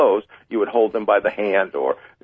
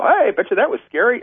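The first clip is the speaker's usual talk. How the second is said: bright, higher, breezy reassurance; breezy upbeat cheer; 11 semitones higher than usual. Shown as a demonstration. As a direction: shrug off the scare cheerfully. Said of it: "you go".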